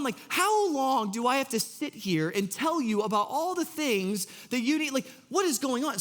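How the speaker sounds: high, pleading disbelief at the disciples; lamenting Jesus. Retold or imitated. Jesus lamenting his disciples' lack of faith, high and pleading. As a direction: ask it like a let-down mentor.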